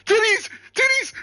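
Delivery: very high, loud gleeful shouting; a twelve-year-old shrieking with glee; excited kid yell. Retold or imitated. Young cousin shouting over the video call, high excited yell.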